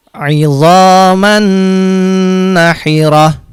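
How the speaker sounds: slow recited "idzaman nahirah" with a humming held n; drawn-out "idzaman" hummed into "nahirah"; held nasal nun. Shown as a demonstration. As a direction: chant "idzaman nahirah", holding the doubled nasal.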